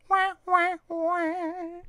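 very high, mock trombone wah wah; sad trombone. Host sings sad trombone for joke, mocking. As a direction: sing the sad trombone after the punchline.

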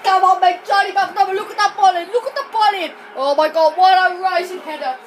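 loud, exultant commentator shout; goal celebration. Real, not acted. Player hails own rising header, loudly exultant. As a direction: bellow praise for the header.

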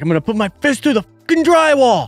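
playful pretend fury at the wall; mock rage. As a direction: fake a tantrum for a laugh. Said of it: "Is that a little, a little too intense?".

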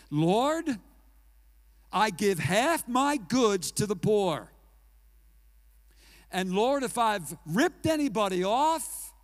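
earnest loud plea of a man giving it all back; Zacchaeus. Retold or imitated. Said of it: "and this is what he said".

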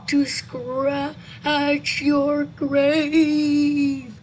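loud, very slow wailing threat; eerie drawn-out moan; spooky creature. Acted. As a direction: drag each word out like a ghoul. Creature answers in the story.

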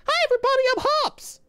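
very high, loud cartoon pet voice; character voice for Hops. Shown as a demonstration. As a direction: speak as the cute pet Hops. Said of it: "repeats greeting in a new voice".

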